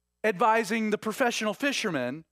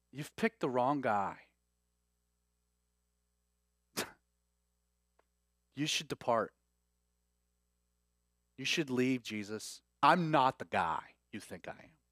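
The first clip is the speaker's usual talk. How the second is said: ashamed Peter; 6 semitones lower, 5 dB quieter than usual. Retold or imitated. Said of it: "He says".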